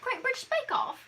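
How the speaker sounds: high-pitched, hammed-up British accent. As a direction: mimic a British baking show contestant. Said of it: "I adore British [name]".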